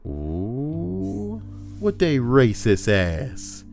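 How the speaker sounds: normal talking voice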